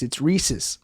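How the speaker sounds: Reese's, second syllable reduced to suhs; Reese's as ree-suhs. Shown as a demonstration. Quietly correcting a friend, ree-suhs. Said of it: "you'd say that's".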